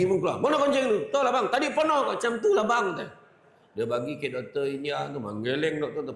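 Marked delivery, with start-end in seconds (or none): 0.4-1.0 higher, louder mock husband demand; hammed-up husband demanding his sample; angry demand. 1.1-2.9 higher, louder, laughing nervous excuse; hammed-up scared wife explaining; mock flustered wife. Scared wife answering husband.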